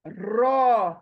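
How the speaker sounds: रॉ full-mouthed and held; thick rounded रॉ; heavy ra. Shown as a demonstration. Teacher modelling the heavy letter. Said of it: "करके देखें आप".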